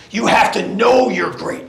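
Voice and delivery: ordinary talk, nothing marked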